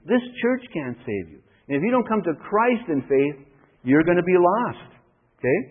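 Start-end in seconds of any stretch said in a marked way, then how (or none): none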